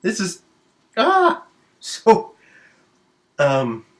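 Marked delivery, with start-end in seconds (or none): 1.0-1.4 high, sharp yelp of pain; retold pained yelp; mock cry of pain. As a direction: yelp like it hurts. Pain at the needle.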